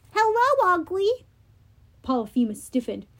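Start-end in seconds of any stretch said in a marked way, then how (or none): 0.1-1.2 shouting. Acted. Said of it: "Annabeth shouted".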